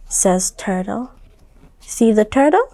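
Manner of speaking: usual speaking voice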